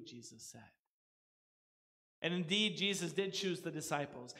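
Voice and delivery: normal talking voice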